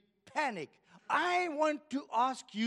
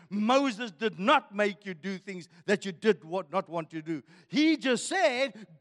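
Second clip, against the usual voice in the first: usual speaking voice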